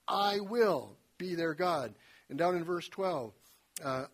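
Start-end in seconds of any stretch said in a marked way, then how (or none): 0.1-1.9 "I will be their God" slowed, WILL weighted; slow stressed I will. Shown as a demonstration. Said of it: "I emphasized them as I read them".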